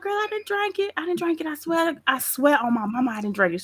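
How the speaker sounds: put-on high, pleading girl-I-swear voice; a playful fib to a scolding friend; mock innocent protest. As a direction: swear you didn't drink it, all innocence. Self quoted swearing innocence.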